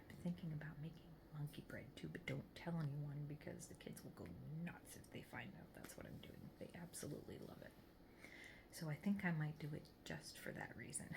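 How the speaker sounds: hushed conspiratorial whisper; secret whisper. Real, not acted. Secret kept from the kids.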